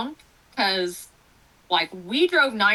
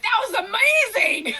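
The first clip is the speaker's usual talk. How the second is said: higher, loud amazed cry from the doctor; amazed shout; 12 semitones higher, 4 dB louder than usual. Retold or imitated. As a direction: shout it out like the stunned OB. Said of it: "he stood up and he was like".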